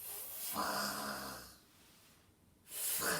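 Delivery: "fr" as a long f sliding into a rasped r, no vowel; isolated blend. Shown as a demonstration. Teacher breaks down franc for pupils, sustaining the blend. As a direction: hold "fr" softly on breath alone. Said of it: "pour la syllabe franc, on a besoin".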